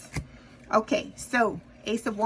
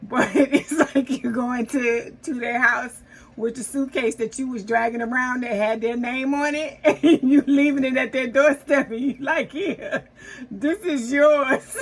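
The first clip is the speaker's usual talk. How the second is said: talking through real giggles, slower and louder; can't stop laughing while picturing it; words bubbling with real laughter; 3 semitones higher, 4 dB louder than usual. Real, not acted. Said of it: "I'm not laughing at y'all".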